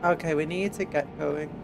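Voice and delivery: ordinary delivery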